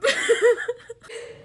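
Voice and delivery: high shaky laugh through tears; a real laugh breaking into crying; tearful high laugh. Real, not acted. Creator to her followers, a tearful high laugh.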